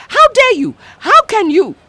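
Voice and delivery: high, loud how dare you; indignant outburst. A narrator's reading. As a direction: hurl the question back at Mama. Daughter confronts her mother.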